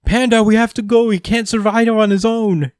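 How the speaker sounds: put-on a child begging his brother to leave; high, urgent kid plea; pleading little sibling. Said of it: "He's like".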